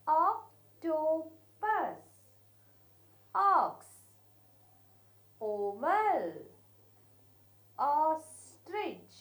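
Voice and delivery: put-on teacher reading the list slowly and loudly to children; slow read-aloud. Shown as a demonstration. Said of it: "Let us read once".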